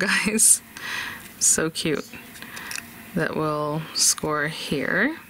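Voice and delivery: ordinary talk, nothing marked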